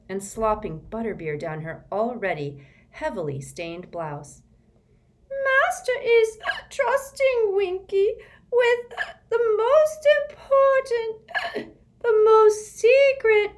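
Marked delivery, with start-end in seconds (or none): 5.3-13.5 high, loud, slow gasping sobs; a proud elf choking on tears; sobbing elf. Acted. Winky boasting through sobs to Harry.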